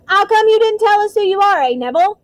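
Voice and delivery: high, loud chummy question; excited conductor. A narrator's reading. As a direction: quiz him eagerly at full voice. Stan excitedly quizzes Harry.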